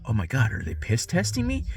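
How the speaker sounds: soft, panicky inner voice; quietly imitating his own alarm; hushed alarmed self-quote. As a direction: mutter your own startled thoughts. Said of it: "and I'm like".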